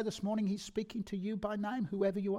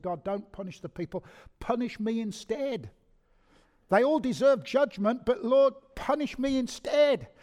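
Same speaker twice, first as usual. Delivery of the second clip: loud, higher pleading, slowing on the last words; pleading cry; 6 semitones higher, 7 dB louder than usual. Acted. Moses begs God to punish him instead, crying out loud. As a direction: cry out to take the punishment yourself.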